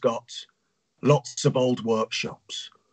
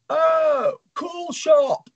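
high, excited hoot of delight; much slower than usual. Acted. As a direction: hoot it out like a thrilled tourist. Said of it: "Oz hoots".

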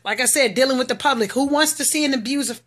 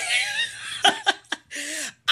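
high-pitched burst of laughter; 5 dB quieter than usual. Real, not acted. Woman amused by her own escape story, laughing high. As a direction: let out a high, helpless laugh.

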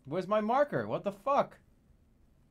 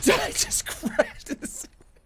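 very high, squeaky through laughter; words swallowed by laughing; helpless laughter. Real, not acted. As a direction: try to speak while cracking up. Cracking up after crash.

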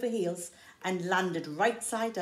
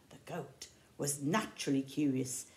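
ordinary delivery, nothing marked

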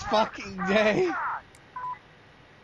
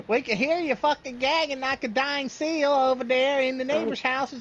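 scolding yell; 8 semitones higher than usual. Retold or imitated. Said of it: "He's gonna get yelled at".

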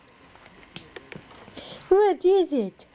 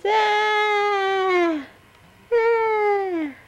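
excited squeal; 10 semitones higher than usual. Real, not acted.

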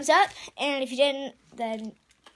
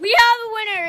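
excited announcement; 6 semitones higher, 8 dB louder than usual. Real, not acted. Announcing the winner.

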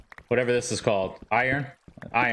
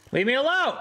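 a pitched-up frantic plea; desperate yell; 11 semitones higher than usual. Real, not acted. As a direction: yell it at the mob. Mob keeps attacking.

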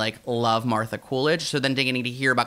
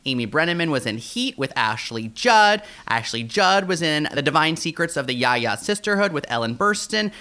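usual speaking voice

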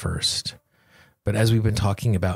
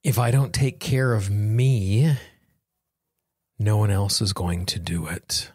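guided tapping affirmations. Acted. Guiding listeners through a tapping session, slow and calm. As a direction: lead the listener gently through each phrase.

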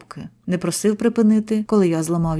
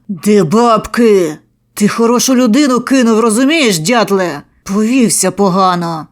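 furious yelling; 2 semitones higher, 8 dB louder than usual. A narrator's reading. Narrator yelling at the beaten debtor, furious. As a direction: scream at him while hitting. Beating victim, demanding money.

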